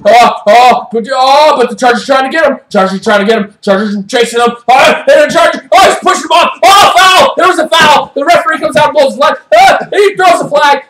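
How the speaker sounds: quick, breathless-paced sports call building to shouts; excited play-by-play. Acted. Recapping the Giants' run to the anchors, excited play-by-play. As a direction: call the foul as if it's happening live.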